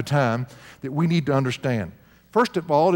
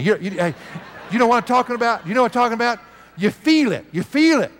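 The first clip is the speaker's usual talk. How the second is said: higher and louder, pressing the point; rising fervour; 7 semitones higher, 3 dB louder, faster than usual. Acted. Preacher pressing the congregation, high and loud. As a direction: stir them up, higher and louder each time.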